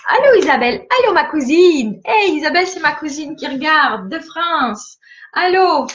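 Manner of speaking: high, loud delighted greeting; salutation enjouée. Real, not acted. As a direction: call out brightly to a cousin just spotted. Greets cousin watching live from France. (allô enjoué, lancé haut et fort)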